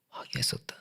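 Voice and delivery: ominous whisper; whispered devil warning. Real, not acted. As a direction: drop to a hushed warning. Ominous echo of own line.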